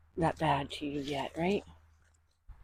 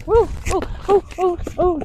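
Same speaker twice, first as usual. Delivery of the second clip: high, loud calls of alarm; sudden loud whoa to steady the animal; real yelps as the horse jumps; startled whoa; 12 semitones higher, 12 dB louder than usual. Real, not acted. Horse startles during spraying.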